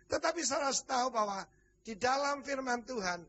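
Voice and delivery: ordinary talk, nothing marked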